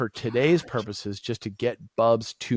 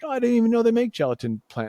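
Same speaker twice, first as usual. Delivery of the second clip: mock surprise; 12 semitones higher, 4 dB louder, faster than usual. Surprised reaction retold.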